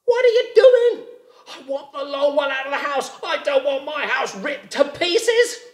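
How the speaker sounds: high, loud, alarmed rabbit; high, frantic rabbit shout. A narrator's reading. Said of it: "the rabbit said".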